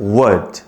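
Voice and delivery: would said as wad, L silent; model pronunciation. Shown as a demonstration. Teacher modelling would for the class as wad. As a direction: say would as wad, starting from a w sound. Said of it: "would를 하지 말고".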